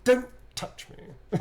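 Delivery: slow, high breathy whisper; Astarion voice line. Shown as a demonstration. Actor showing the booth voice, slow high whisper. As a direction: breathe it out slowly as the vampire. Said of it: "in the voice, in the mocap booth".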